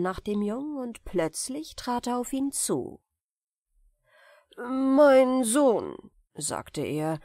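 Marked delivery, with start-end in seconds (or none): 4.6-6.0 raised, louder stranger's voice; sorcerer's greeting. Acted. A stranger hails a boy in the street, sly and loud.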